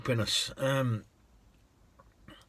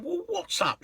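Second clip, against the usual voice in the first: much higher, light question; concerned; 3 dB quieter than usual. Retold or imitated. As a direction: ask what's wrong. Driver asks the lady.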